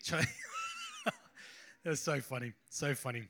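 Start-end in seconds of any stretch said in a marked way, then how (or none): 0.3-1.1 high, squealed burst of laughter; laughing. Real, not acted. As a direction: crack up mid-word, pitch shooting up. Cracks up telling China story.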